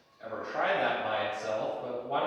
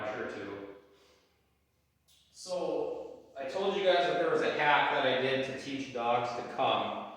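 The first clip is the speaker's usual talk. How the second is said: normal talking voice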